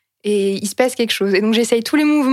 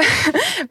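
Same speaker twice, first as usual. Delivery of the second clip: laugh; 9 semitones higher than usual. Real, not acted. Laughing at the farting horse.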